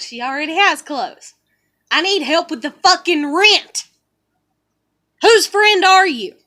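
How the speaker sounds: a loud, rising indignant reply; angry retort. Retold or imitated. Snapping back at the maintenance people, angrily. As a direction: throw the questions back at them hard.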